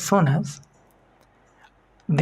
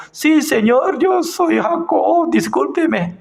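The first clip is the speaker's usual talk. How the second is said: much higher, earnest humble answer; honest Jacob; 10 semitones higher than usual. Pretend. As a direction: own up plainly as Jacob, pitched high. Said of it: "entonces yo".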